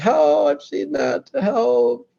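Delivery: very high, loud and slow; squealing mock despair; desperate whine. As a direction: wail for help, hammed-up. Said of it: "And you're like".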